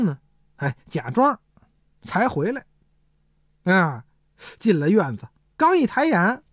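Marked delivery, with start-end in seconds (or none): none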